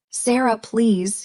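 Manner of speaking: usual speaking voice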